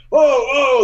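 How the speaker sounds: high, loud amazed whoa; Hulk Hogan shout. Retold or imitated. Wrestler reacting to a performance, amazed high shout. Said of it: "But he was like".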